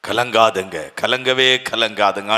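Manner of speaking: low, soft கலங்காதுங்க; gentle reassurance. Shown as a demonstration. Preacher softly reassuring the frightened congregation not to fear. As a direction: say it quietly, soothing. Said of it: "repeated softly".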